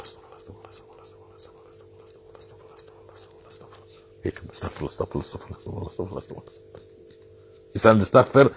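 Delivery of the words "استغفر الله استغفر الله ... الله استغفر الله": rapid unvoiced repetition over prayer beads; the quiet rhythmic whisper of a repeated prayer. Shown as a demonstration. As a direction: barely breathe the words while counting beads. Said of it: "بس بصوت واطي".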